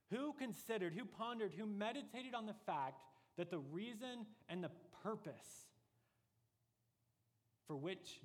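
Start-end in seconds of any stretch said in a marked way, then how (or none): 0.1-3.6 each "who" pitched high and pressed; building questions. Shown as a demonstration. Preacher pressing the congregation to ponder, repeated high "who". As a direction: stack the "who" questions, rising. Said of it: "And then comes the question".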